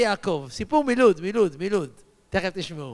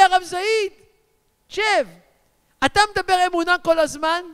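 lightly mimicked deaf elder, high and loud; imitated old man's loud address; hard-of-hearing old man; 9 semitones higher, 5 dB louder than usual. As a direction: call out raised and loud, as if you can't hear yourself.

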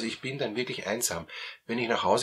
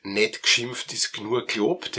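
slow, louder Austrian dialect saying; 6 dB louder, slower than usual. Shown as a demonstration. Therapist quoting an Austrian proverb to the viewer, in slow Austrian dialect. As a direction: lean into the Austrian idiom. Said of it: "sagt man auf Österreichisch".

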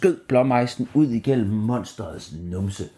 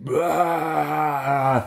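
monster roar; 6 semitones higher than usual. A narrator's reading. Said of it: "det brølede ostemonsteret".

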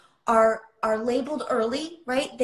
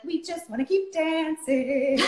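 higher, drawn-out sing-song mimicry; sing-song oblivious partygoer; 5 semitones higher than usual. Titanic passengers ignoring the iceberg warning, sing-song mockery. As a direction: chant it slowly, mocking carefree dancers.

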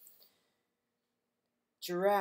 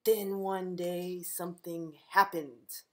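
slow, drawn-out mystery line; slow down to build suspense; suspenseful; slower than usual. A narrator's reading. Librarian reading to kids, slow suspense.

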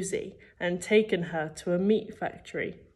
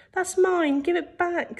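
very high, helpless plea; hopeless protest; 11 semitones higher, 3 dB louder than usual. A narrator's reading. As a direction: plead in a high voice, expecting to lose. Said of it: "said Danny hopelessly".

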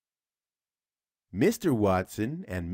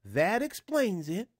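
higher put-on voice for the husband; Mister Watson's voice; 10 semitones higher than usual. A narrator's reading. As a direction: voice him higher than the narrator. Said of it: "said Mister Watson".

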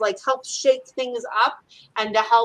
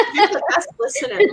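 mom's voice pitched up; 10 semitones higher, 4 dB louder than usual. Retold or imitated. Mom through the medium praising Carol, much higher. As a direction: repeat it much higher, lifted as the mother.

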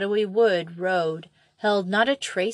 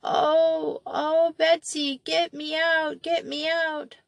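put-on high, trembling child sobbing; inconsolable weeping; 9 semitones higher, slower than usual. A narrator's reading. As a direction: plead through tears, slowly. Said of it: "came Molly's sobbing voice".

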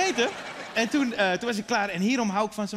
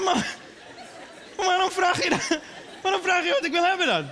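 indignant protest; 7 semitones higher than usual. Retold or imitated. Son protesting to his mother about broccoli, indignant. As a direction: object in disbelief.

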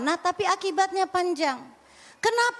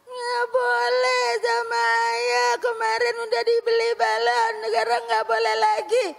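put-on whining child; 7 semitones higher, 3 dB louder than usual. Child whining to mother that father forbade the balloon. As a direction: complain like a teary little kid.

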